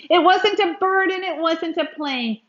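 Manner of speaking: ordinary delivery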